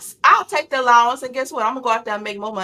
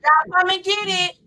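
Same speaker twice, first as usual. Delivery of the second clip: calling out; 6 semitones higher than usual. Pretend. Calling family to dinner.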